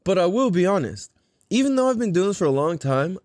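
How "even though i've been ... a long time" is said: normal talking voice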